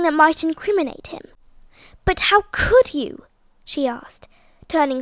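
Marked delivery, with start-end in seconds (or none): 2.0-3.2 high, loud accusing question; dismayed outburst. A narrator's reading. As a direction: turn on him in shocked disbelief. Daisy turns suddenly on Stephen.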